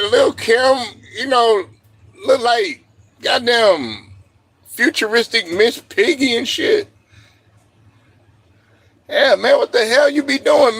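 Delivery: ordinary talk, nothing marked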